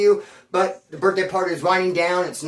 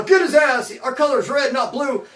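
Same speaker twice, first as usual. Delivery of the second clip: raised-voice imitation of the gang; retold gang yell; 5 semitones higher than usual. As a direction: call it out like an indignant gang member. Retold gang members shouting orders.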